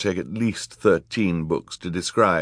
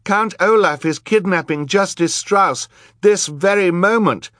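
put-on very high, loud girl's appeal; urgent plea; 12 semitones higher, 6 dB louder than usual. A narrator's reading. Girl warning the crowd of a kidnapping, urgent high cry. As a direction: raise the alarm, pleading high and loud. Violet begs the crowd for help.

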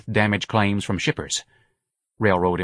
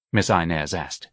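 ordinary delivery

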